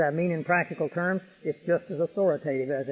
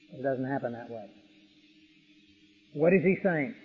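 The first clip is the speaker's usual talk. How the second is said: ordinary talk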